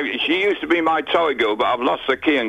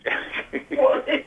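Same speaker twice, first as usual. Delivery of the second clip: high, airy chuckle; high breathy laugh; 13 semitones higher, 3 dB quieter than usual. Real, not acted. Laughs at question about wife.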